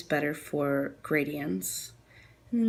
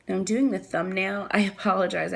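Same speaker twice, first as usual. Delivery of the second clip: ordinary talk, nothing marked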